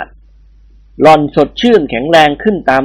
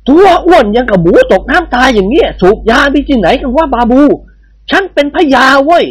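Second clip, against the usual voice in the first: put-on higher, indignant old lord scolding; mock angry shout; 9 semitones higher than usual. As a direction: bluster at the babu. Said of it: "เอ็ดตะโรลั่น".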